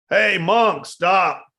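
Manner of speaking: high, loud call after the monk; a bandit hollering across the valley; bandit shout. Retold or imitated. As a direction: bark it down the path at him.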